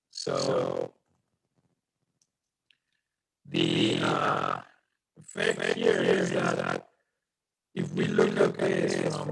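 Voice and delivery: deep distorted glitchy call audio; monster voice. Real, not acted. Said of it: "Is anyone else getting the monster voice?".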